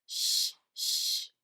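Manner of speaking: loud urgent shush. A narrator's reading. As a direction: shush them, pleading. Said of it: "begged Grump".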